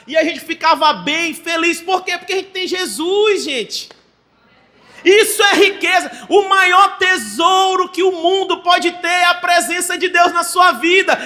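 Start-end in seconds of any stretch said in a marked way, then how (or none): 5.0-11.2 loud, high-pitched preaching; pregação inflamada. Real, not acted. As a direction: proclaim it to the congregation at full force. Preaches at full force. (pregação forte e exaltada)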